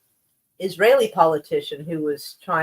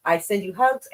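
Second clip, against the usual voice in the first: ordinary talk, nothing marked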